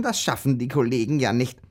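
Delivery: ordinary talk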